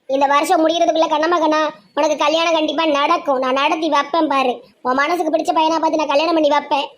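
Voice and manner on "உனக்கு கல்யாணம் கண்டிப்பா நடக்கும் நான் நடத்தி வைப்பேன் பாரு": normal talking voice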